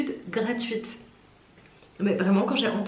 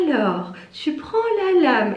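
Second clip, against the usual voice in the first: high, loud, exaggerated talking-down; infantilising; 6 semitones higher, 5 dB louder than usual. Warehouse worker showing her a cutter repair, imitated as infantilising. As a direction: mimic explaining to a twelve-year-old. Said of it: "il me parlait vraiment comme ça".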